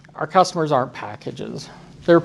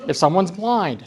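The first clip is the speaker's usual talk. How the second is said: usual speaking voice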